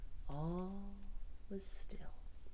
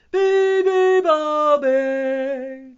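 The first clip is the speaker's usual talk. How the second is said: loud, high, drawn-out elephant call; elephant's blaring chant; trumpeting; 11 semitones higher, 20 dB louder, much slower than usual. A narrator's reading. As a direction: blare it slowly like a trumpet. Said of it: "trumpeted the elephant".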